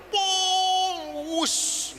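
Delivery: high drawn-out whoosh of a speeding car; vocal sound effect, car racing off; imitated car whoosh. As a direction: zoom past with a long "wus".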